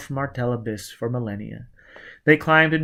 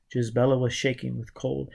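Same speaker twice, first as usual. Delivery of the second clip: usual speaking voice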